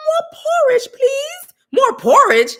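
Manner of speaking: high, loud, whiny little-orphan begging; pleading orphan. Pretend. Mocking people cut off from funding, begging in a high crying whine. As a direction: beg for scraps like a starving waif, crying. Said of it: "It's like".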